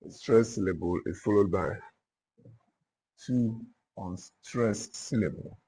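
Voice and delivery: deliberate dictation, long pauses between phrases. Real, not acted. Teacher dictating the dactylic foot to note-takers, dictation. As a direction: dictate it in small repeated chunks for note-takers.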